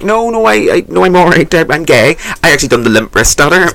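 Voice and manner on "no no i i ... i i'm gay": high, louder stammering refusal; flustered refusal. Retold or imitated. Refusing a masseuse's offer, high stammering refusal. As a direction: stumble out of the offer.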